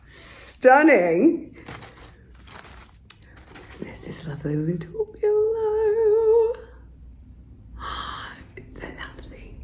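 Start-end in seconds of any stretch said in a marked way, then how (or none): 3.8-9.6 hushed, breathless whisper; awed whisper. Real, not acted. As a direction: whisper in amazement. Awed whisper over pillow.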